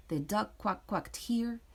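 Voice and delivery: ordinary delivery, nothing marked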